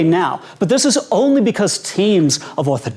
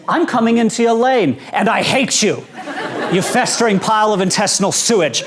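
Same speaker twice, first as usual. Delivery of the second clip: higher-pitched hammed-up hateful tirade; mock road rage; 4 semitones higher than usual. Utah driver cutting into another's lane, mock-furious tirade. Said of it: "Here's how a turn signal is interpreted in Utah".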